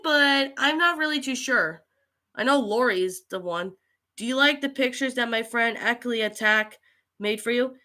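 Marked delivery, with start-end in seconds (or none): none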